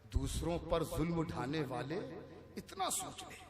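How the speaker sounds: soft, hushed recitation; quiet verse recital. Acted. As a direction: hand the line over quietly. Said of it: "जो शेर धीरे से पढ़ दिया जाए". (धीरे से शेर पढ़ना)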